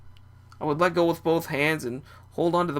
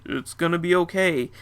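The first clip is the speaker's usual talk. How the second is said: ordinary delivery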